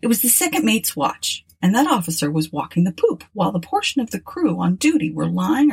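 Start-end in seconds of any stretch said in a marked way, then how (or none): none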